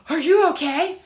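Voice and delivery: high, loud gasp then worried question; alarmed gasp. Retold or imitated. Friends at the mall rail, alarmed gasp and high question. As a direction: gasp as if your friend might fall.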